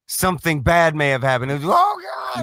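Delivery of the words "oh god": high, shrieked panicky wail; hammed-up frantic "Oh, God"; mock panicked cry. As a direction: squeal it like a man losing his mind. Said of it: "he'll go like crazy".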